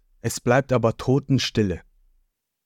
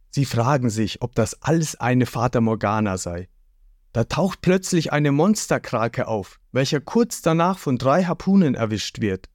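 usual speaking voice

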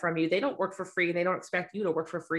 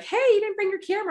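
high, louder imitation of a surprised friend; mock surprised friend; 4 dB louder than usual. Friend noticing the photographer brought no camera, mock surprised. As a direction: squeak it out like a let-down pal. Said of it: "they'll always say".

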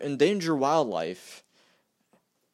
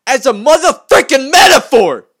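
louder and higher, fed up; furious ranting outburst; exasperated shout; 9 semitones higher, 15 dB louder than usual. Real, not acted. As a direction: blow up at the word motherfucking. Exasperated rant about Trump quote.

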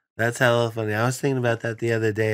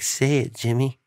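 pushy parent; 4 semitones higher than usual. Acted. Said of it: "parent that's standing right behind you".

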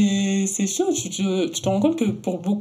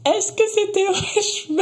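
high, mocking voice of a clueless asker; mocking naive voice; 13 semitones higher, 3 dB louder than usual. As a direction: play a clueless curious stranger, high. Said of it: "quand t'as tes tresses".

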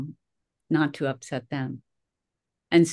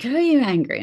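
higher, louder mock challenge; mock accusing question; 9 semitones higher, 6 dB louder, faster than usual. People quizzing her in business courses, loud imitated challenge. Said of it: "they're like".

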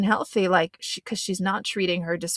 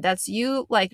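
normal talking voice

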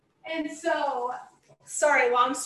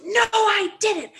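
high, loud, slow denial; imitated scream; 10 semitones higher, 6 dB louder, slower than usual. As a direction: imitate a passenger yelling back in denial. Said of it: "starts screaming at him".

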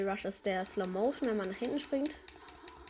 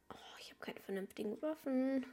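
whispering; 4 semitones higher, 7 dB quieter than usual. Real, not acted. Weapon broke, muttered.

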